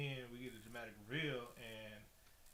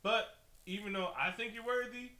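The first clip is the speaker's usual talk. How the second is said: raised regal voice; 8 semitones higher, 6 dB louder than usual. Retold or imitated. Retold queen offering trident.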